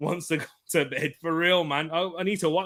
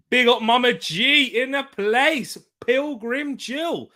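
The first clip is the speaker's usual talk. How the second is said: hype shout-out; 8 semitones higher, 5 dB louder, slower than usual. Acted. Shout-out to a viewer.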